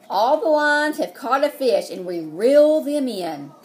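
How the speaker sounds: put-on lively read-aloud to kids; excited read-aloud. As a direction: build the fishing excitement. Story narration, excitement.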